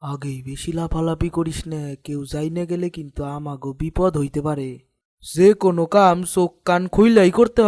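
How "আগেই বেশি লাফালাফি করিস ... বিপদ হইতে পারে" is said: scheming village headman hushes his partner, softly; hushed warning. Acted. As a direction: lower the voice so no one overhears. Warning a co-conspirator about danger.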